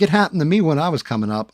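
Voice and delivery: imitating the manager justifying himself; mock whiny manager. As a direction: rattle it off like a defensive boss. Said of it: "He's like".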